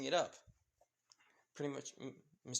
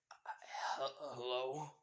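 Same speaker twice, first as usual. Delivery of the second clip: fearful; 5 dB quieter than usual. Acted. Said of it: "Katsuki just feeling fear".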